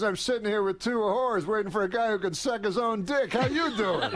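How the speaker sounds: high, cracking up, mock Rodney voice; Rodney's answer. Rodney telling Saget about his evening, mock Rodney voice with laughter. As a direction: toss off the punchline as Rodney, near laughter. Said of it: "He goes".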